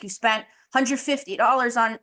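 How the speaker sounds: normal talking voice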